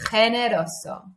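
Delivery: "generoso" opening on a rasping "kh"; throaty Spanish G. Shown as a demonstration. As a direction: scrape the first sound of "generoso" at the back of the throat. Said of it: "it's that G sound".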